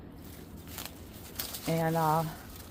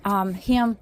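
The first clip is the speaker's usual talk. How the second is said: ordinary talk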